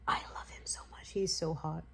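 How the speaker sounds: breathy secret whisper of a crush. Real, not acted. Fan whispering to viewers about her crush, hushed. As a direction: whisper it like a confession.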